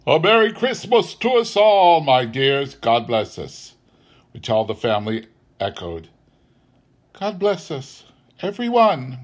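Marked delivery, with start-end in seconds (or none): 0.1-3.8 raised Christmas toast, settling by the end; Bob's hearty toast. A narrator's reading. Bob proposes a Christmas toast to all the family, hearty. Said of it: "Then Bob proposed". 7.1-9.2 put-on small boy's high-pitched blessing; Tiny Tim. A narrator's reading. Little boy blesses the family last of all, childlike. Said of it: "said Tiny Tim".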